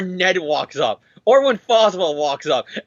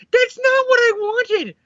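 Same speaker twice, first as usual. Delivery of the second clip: high, drawn-out mock wail; whiny protest; slower than usual. Hero told his loved ones are alive, mock wailing protest. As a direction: cry it out slowly in a high, upset voice.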